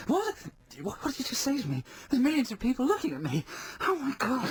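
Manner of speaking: high, indignant disbelief; shocked outrage. Retold or imitated. Mock contestant reacting to the magician on stage, high and outraged.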